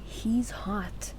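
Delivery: breathy whispered aside. Acted. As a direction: whisper it like a secret crush. Whispered aside about Bruno.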